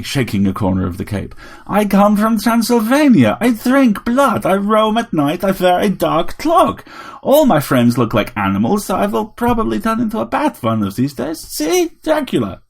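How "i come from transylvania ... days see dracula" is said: higher, louder put-on Transylvanian voice; a tiny robot playing a grand vampire; robot Dracula. A narrator's reading. Vampire robot telling Harry it wants all his blood.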